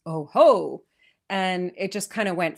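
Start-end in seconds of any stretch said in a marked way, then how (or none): 0.1-0.8 a hearty, louder Santa "Oh, ho"; Santa's laugh. Retold or imitated. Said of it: "and he goes".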